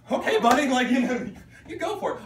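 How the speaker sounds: high, loud amused brush-off; amused disbelief. Retold or imitated. Teacher who couldn't take the student seriously, amused disbelief. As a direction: humour him at the top of your range.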